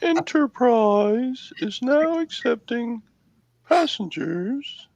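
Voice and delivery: high, slow robotic announcer; automated voice. Acted. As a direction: announce like a ship's computer. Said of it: "I try to do an automated voice".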